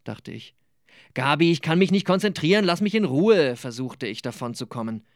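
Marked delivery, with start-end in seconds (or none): 1.1-3.6 higher, quick, mildly exasperated plea. A narrator's reading. Nicola trying to get out of Gabi's lecture, exasperated plea. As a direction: rush to get him off your back.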